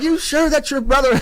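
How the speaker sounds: high-pitched mock disbelief; incredulous. Gang asking older brother about the singer, high incredulous question.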